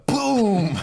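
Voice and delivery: loud, high shouted boom; mock gunshot boom. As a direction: blast it out like a gun. Gunshot sound effect.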